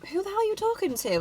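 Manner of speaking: high, fast indignant friend; offended friend. Pretend. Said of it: "your friend would be like".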